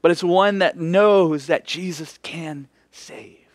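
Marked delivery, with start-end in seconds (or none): 1.5-3.5 slow whispered conviction; hushed, sincere reassurance; whispered assurance. Real, not acted. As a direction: lean in and whisper it. Sermon point hushed.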